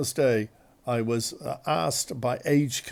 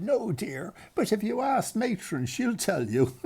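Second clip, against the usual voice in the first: put-on old lady; 9 semitones higher than usual. Old woman in wheelchair answers.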